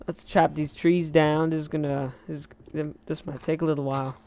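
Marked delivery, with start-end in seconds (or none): none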